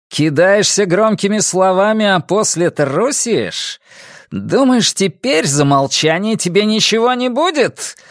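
high, threatening challenge; angry taunting. A narrator's reading. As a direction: taunt the coward angrily. Armed count taunts the retreating peasant.

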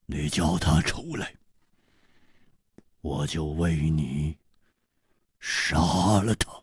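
low, quiet, slow and icy; cold killer. Acted. Killer offering to slay the man if called out, cold. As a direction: drop low and measure out each phrase.

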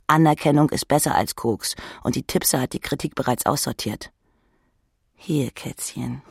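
ordinary delivery, nothing marked

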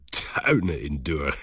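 slow, scornful words breaking into laughter; laughing. A narrator's reading. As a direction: draw it out, then laugh at the idea. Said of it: "begon te lachen". (lachend en langgerekt)

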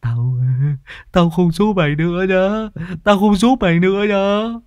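high, blubbering protest; tearful, childish whine; whining, near tears. A narrator's reading. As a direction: wail like a sulking madman. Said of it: "lão Hùng Lác mếu máo".

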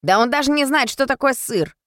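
high-pitched, hurt protest; offended grumble. A narrator's reading. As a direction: protest as if mildly insulted. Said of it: "обиженно пробормотал Немо".

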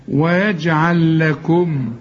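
ويجعل لكم slow, the two lams merged into one doubled lam. Shown as a demonstration. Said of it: "ادغمها في اللي بعدها".

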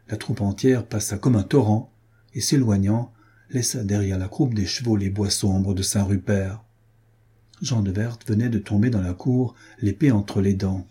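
plain reading voice

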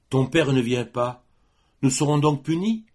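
acted anguish, high and forceful; anguished girl. Girl fears they are being punished.

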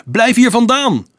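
high, loud shout; urgent yell across a distance; called-out warning. A narrator's reading. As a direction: shout to keep him away. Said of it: "riep Dolf hem toe".

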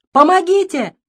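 high loud desperate cry; shout for help. Acted. Father cries out for help, loud desperate scream. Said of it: "а потом крикнул".

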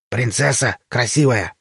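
slow, dreamy creature explanation. A narrator's reading. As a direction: linger admiringly on each word as the creature. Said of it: "мечтательно закатив глаза".

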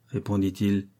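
plain reading voice

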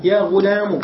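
"غلام" said "ghulamu"; damma ending. Shown as a demonstration. Teacher reading out the three vowellings, u ending. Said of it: "بالكسر والفتح والضم".

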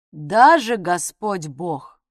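ДАЖЕ Господь Бог, slow and emphatic; slow firm stress. Acted. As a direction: lean hard on ДАЖЕ, drawn out.

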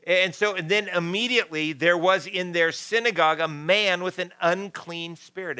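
usual speaking voice

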